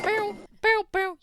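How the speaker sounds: high loud buzzing hornet; hornet impression. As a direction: buzz like an angry hornet. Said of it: "is that a good hornet impression".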